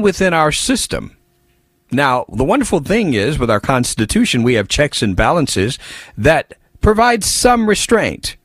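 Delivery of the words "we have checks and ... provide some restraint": weight "some" to show the restraint is only partial; stress on some. Shown as a demonstration. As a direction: lean on the word "some" in "provide some restraint". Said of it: "I emphasize that word, some".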